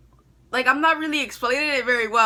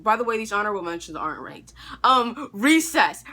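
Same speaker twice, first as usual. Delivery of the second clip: normal talking voice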